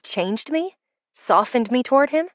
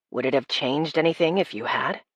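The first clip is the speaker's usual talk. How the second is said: lightly lowered voice for Chase; a hint of a low, quiet guy; male love interest; 7 semitones lower, 6 dB quieter than usual. A narrator's reading. Chase's voice in the story.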